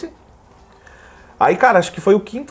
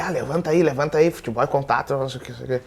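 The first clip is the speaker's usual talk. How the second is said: low murmur straight into his ear; muttered in his ear; 3 semitones lower, 7 dB quieter, faster than usual. Retold or imitated. Teammate murmuring into the fallen striker's ear, quietly taunting. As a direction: lean in and needle him quietly. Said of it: "foi no ouvido dele".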